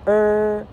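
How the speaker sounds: 呃 with the tongue curled back, like "er"; r-coloured vowel. Shown as a demonstration. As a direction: curl the tongue through 呃. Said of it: "卷舌了，".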